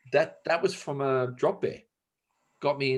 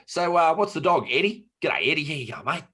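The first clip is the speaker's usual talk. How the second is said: loud, higher-pitched broad Aussie matey greeting; broad Australian; 6 semitones higher, 6 dB louder than usual. Acted. Greeting the woman's dog, exaggerated broad Australian. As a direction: lay on the Australian accent to annoy. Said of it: "do the Australian thing and annoy".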